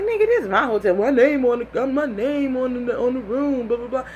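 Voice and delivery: high, insistent argument; heated arguing. Retold or imitated. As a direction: claim the room with heat. Arguing it is her room.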